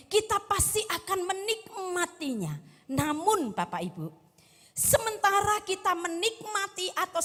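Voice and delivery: high-pitched, driving sermon delivery; impassioned preaching. Real, not acted. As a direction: preach it with rising urgency to the congregation. Preaches with raised voice.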